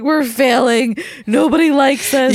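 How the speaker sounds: high, loud, wailing self-pity; mock despair. Mom retelling her meltdown to her friend, high hammed-up wail. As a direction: overdramatize your own meltdown. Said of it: "But I'm like".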